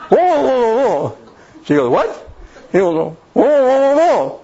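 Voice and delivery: high strained whoa sounds, half laughing; mock mute husband grunting at his wife. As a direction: struggle to get words out, pitched well up.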